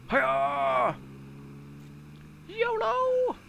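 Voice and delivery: high, loud whooping yell; over-the-top high yell; excessive shout. Real, not acted. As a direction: shout yolo like a party animal. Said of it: "That was a bit excessive".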